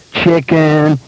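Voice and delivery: put-on high, taunting child's call; sing-out teasing dare; gleeful child's cry. A narrator's reading. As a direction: cry it out high like an ecstatic boy. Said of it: "he cried to his dad".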